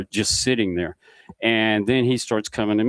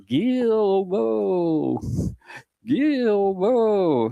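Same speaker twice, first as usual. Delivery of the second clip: high, drawn-out call with falling end; long, wailing holler, held back in volume; distant calling; 10 semitones higher, much slower than usual. Retold or imitated. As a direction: imitate someone calling a name across the water. Faint call heard over the wind.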